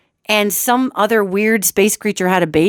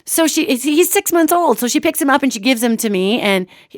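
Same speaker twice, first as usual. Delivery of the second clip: usual speaking voice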